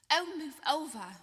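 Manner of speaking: loud, impatient snarl; sharp, loud, annoyed. A narrator's reading. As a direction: shove past them in irritation. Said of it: "Hermione snarled".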